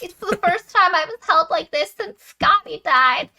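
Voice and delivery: high-pitched, hammed-up heroine voice; mock emotional heroine. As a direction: imitate her overcome, pitched well up. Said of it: "And she was like".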